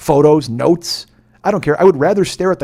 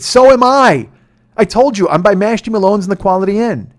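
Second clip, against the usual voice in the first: exasperated shout; 7 semitones higher, 9 dB louder than usual. Retold or imitated. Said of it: "frustrated driver shouting at lost rider".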